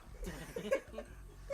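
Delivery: silent breathy wheeze of laughter; real wheeze; wheezing laugh. Real, not acted. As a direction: laugh with no voice left. Cracking up mid-story.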